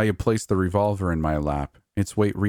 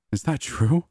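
ordinary delivery, nothing marked

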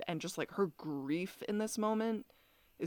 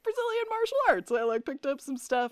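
Mason hammed up in a much higher voice; mock Mason; 9 semitones higher, 5 dB louder than usual. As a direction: squeak the excuse out way up high.

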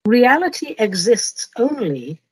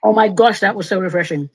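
usual speaking voice

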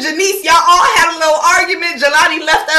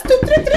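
high doot doot; 11 semitones higher than usual. Pretend. Chanting entrance to club.

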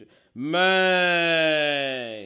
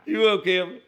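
calling out; 5 semitones higher, 7 dB louder than usual. Real, not acted. Calling out to a chat viewer.

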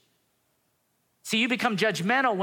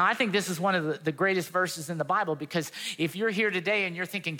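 ordinary talk, nothing marked

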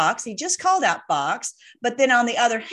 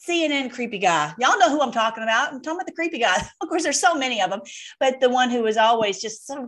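ordinary delivery

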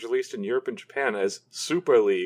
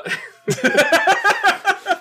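burst of laughter; 4 dB louder than usual. Real, not acted. Asked how many butterflies fought.

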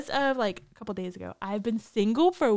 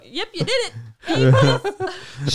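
oblivious teacher voice; 7 semitones higher, 4 dB louder, much slower than usual. Retold or imitated.

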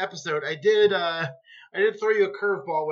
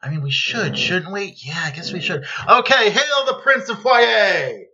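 confer among yourselves, then burst into a grand hail; acted crowd conferring; 2 semitones higher, 5 dB louder than usual. Co-host playing listeners deciding to accept the prince, mock deliberation into shouted acclaim.

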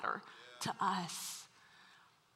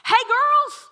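high, loud, upbeat take-charge Jesus; breezy cheerful Jesus; 11 semitones higher, 12 dB louder than usual. Pretend. Jesus telling grieving Mary and Martha to cheer up, high and hammed-up. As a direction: play a peppy Jesus cheering up the crying sisters. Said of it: "it should have gone down something like this".

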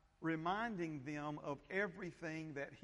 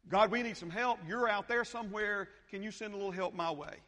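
much louder, higher, begging cry for help; mock pleading prayer; 7 semitones higher, 9 dB louder than usual. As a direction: pretend to beg God to send help. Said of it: "that's how outside in thinking goes".

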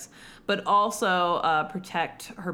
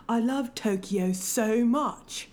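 put-on British accent; 4 semitones higher than usual. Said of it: "just has a straight-up British accent".

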